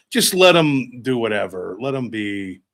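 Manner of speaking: normal talking voice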